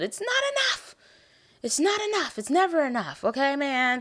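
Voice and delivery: high, whiny fan complaint; worked-up, high-pitched grievance; exasperated protest. Real, not acted. Playful protest about too-short cameo.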